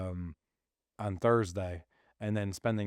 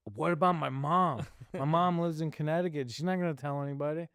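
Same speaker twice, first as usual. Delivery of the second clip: higher, pleading retold protest; 5 semitones higher than usual. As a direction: plead your case to your wife.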